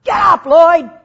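full-throated command to rise; commanding shout. Pretend. Preacher shouting at a dead man to rise, loud command. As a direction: bellow it at the body to get up.

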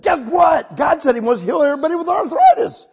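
loud, high preacher's shout; excited announcement. Retold or imitated. Preacher tells the church God will heal, shouting excitedly. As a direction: call it out to a whole congregation.

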